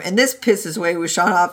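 ordinary delivery

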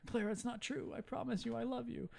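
high-pitched imitation of a plea; mock pleading. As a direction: plead softly up high, as a retold lover. Doc pleads with angry Clara.

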